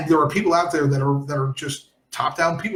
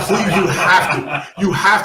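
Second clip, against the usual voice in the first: laughing; 6 dB louder than usual. Real, not acted. Excited laughing request.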